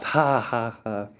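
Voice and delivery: flat, forced ha ha ha; fake laugh. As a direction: laugh on purpose without meaning it. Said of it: "let's pretend it was funny and just laugh".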